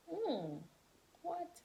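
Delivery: high-pitched puzzled reaction; retold bafflement. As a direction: act out a baffled double take. Retold baffled reaction to driving side.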